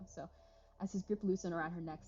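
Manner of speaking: usual speaking voice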